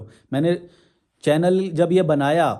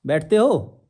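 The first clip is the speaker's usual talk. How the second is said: ordinary delivery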